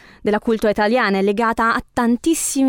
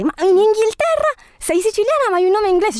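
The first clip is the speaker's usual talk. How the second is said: amazed; 12 semitones higher, faster than usual. Real, not acted. Amazed question about the name.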